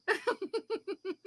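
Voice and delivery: high breathless giggle, trailing off; helpless giggle. Real, not acted. Friend laughing at a joke she can't repeat, breathless giggle.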